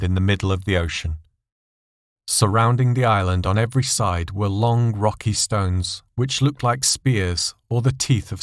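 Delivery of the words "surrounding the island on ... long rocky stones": usual speaking voice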